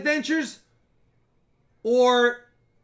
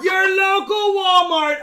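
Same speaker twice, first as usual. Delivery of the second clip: announcer voice; 9 semitones higher, 8 dB louder, slower than usual. Pretend. Repeated joke punchline.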